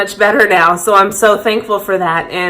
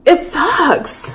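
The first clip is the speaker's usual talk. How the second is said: getting emotional; 6 semitones higher than usual. Real, not acted. Admitting the pain about her dad, voice catching with emotion. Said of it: "I'm getting emotional now".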